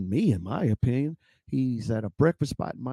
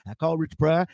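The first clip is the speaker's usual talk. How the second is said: ordinary talk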